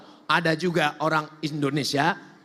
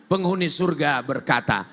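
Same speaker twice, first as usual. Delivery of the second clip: usual speaking voice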